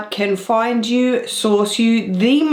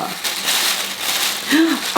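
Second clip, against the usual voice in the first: gasp; 7 semitones higher, 3 dB quieter than usual. Real, not acted. Finding a surprise lipstick.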